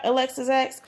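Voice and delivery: usual speaking voice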